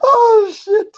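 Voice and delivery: high, helpless laughing exclamation; cracking up. Real, not acted. Buddy reacting to his friend's story, cracking up. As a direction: squeal it through laughter.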